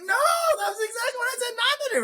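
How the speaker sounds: very high, squeaky disbelief; exasperated squeal. Retold or imitated. Exasperated doctor hearing bad news.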